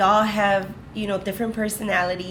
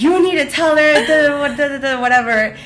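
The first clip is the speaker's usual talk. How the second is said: pleading line sisters; 6 semitones higher, 6 dB louder than usual. Retold or imitated. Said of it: "these girls would just come and be like".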